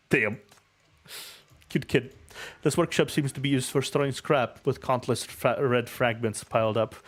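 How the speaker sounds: ordinary talk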